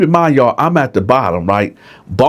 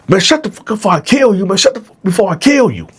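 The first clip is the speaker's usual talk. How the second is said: fast, higher, menacing threat; threatening; 8 semitones higher, faster than usual. Retold or imitated. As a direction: threaten to kill him if he talks. Attacker threatening.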